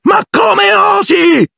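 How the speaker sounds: very high, drawn-out shriek. Acted. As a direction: scream in outrage. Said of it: "Alicia strillò". (strillo acutissimo e indignato)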